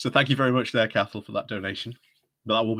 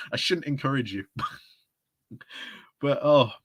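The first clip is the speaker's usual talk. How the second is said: usual speaking voice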